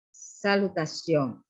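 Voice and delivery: usual speaking voice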